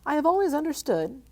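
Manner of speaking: frightened squeak. Acted. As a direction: squeak it out in fear. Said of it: "in a frightened squeak".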